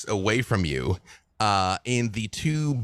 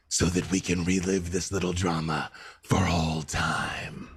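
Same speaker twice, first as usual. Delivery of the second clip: gloating warlord; 6 semitones lower than usual. Acted. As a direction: sneer it down at her. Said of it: "and just says".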